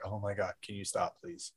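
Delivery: low, very soft mock plea; pained British listeners. British listeners beg the host to stop, low and hushed. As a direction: imitate an embarrassed plea under your breath. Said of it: "all the British people are like".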